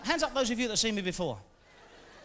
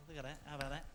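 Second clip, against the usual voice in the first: a hint of Van Gogh posing, softer; understated mock pose; quick impression; 7 semitones lower, 16 dB quieter, faster than usual. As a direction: strike a pose and present it. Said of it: "here's a quick impression".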